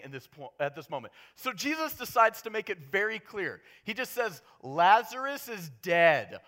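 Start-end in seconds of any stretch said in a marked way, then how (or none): 4.6-6.4 slow. Retold or imitated. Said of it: "as slow as possible".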